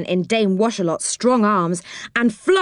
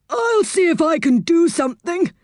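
put-on much higher, helpful old saucepan man; 10 semitones higher than usual. A narrator's reading. Saucepan offering to help Moonface, much higher voice. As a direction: pipe up unexpectedly as Saucepan. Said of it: "said the old saucepan man unexpectedly".

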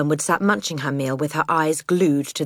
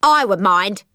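loud, higher irritated father; snapped refusal; 5 semitones higher, 5 dB louder than usual. Acted. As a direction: snap back at the child. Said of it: "he snapped".

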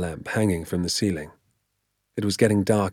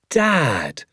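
cried out; 6 semitones higher than usual. Acted. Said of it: "I cried".

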